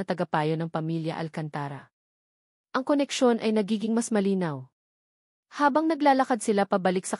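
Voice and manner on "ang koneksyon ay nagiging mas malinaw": ordinary delivery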